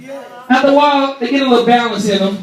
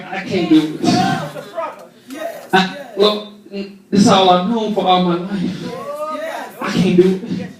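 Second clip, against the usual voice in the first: low, soft complaining plea; a hint of a sulking believer; mildly whiny low plea to God; mock whining prayer; 5 semitones lower, 4 dB quieter than usual.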